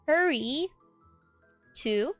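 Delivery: ordinary delivery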